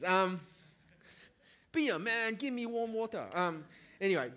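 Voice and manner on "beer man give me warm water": higher-pitched mock Chinese accent; Russell Peters Chinese accent. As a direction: do a comic's exaggerated Chinese-parent accent. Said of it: "watching too much Russell Peters".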